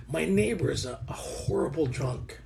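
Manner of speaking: hushed, confiding undertone; near whisper. Real, not acted. Speaker confides about a drunk neighbor, near whisper. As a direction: drop the volume, as if telling a secret. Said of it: "I don't know why I'm whispering".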